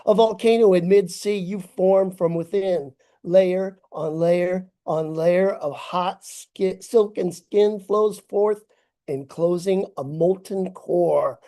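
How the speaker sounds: ordinary delivery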